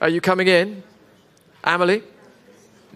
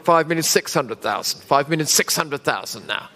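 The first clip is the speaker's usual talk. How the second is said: auctioneer; 3 semitones lower than usual. Real, not acted. Live auction bid calling.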